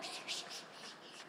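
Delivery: repeated unvoiced shushing noise; frantic hushing. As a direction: shush someone over and over. Hushing a crying woman.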